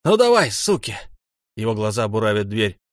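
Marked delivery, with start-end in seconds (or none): 0.1-1.0 high, loud taunt; furious yell. A narrator's reading. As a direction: yell a dare at the enemy. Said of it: "орёт в сторону двери".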